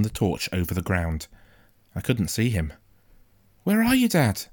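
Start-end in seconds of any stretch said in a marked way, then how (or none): 3.6-4.5 high-pitched searching call. A narrator's reading. Boy looking for his father with a torch, calling out. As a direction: call for someone out of sight.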